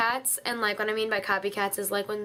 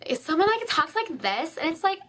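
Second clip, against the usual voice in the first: much higher, put-on voice; fake high voice; 7 semitones higher than usual. Mocking people who talk in fake high voices, high. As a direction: imitate someone faking a high voice. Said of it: "it's, like, a fake voice".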